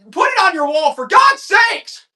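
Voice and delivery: high, loud pleading holler, last words drawn out; exasperated yell. Acted. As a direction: shout it high, stretching the final words. Hyped auction seller exclaims.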